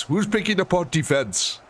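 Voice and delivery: jokingly puts on an African accent for the line. Shown as a demonstration. Joking to viewers about the defense, in a mock African accent. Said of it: "you say that in an African accent".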